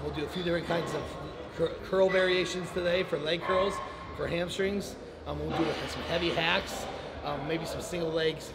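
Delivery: normal talking voice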